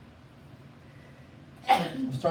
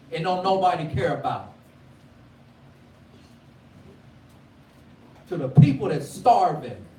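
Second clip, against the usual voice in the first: preaching; 4 semitones higher than usual. Acted. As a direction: build the sermon to a ringing peak. Preacher building to full force.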